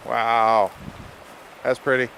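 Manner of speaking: ordinary talk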